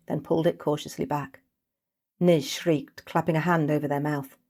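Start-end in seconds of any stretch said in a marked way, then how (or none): none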